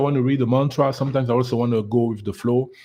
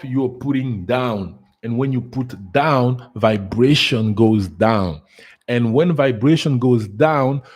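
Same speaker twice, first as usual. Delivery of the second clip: slow emphatic; 3 dB louder, slower than usual. Real, not acted.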